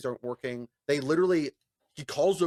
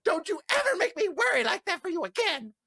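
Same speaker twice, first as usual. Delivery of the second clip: much higher, scolding start; action-movie mom. Retold or imitated. To friend after his win, mock mom scolding. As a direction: scold like a relieved mother. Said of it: "like a mom from a fucking action movie".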